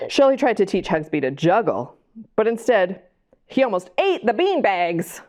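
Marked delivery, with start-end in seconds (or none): none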